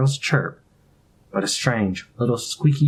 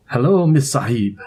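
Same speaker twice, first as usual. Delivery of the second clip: put-on Indian accent; 3 semitones higher, 4 dB louder than usual. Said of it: "The familiar cadences of his accent".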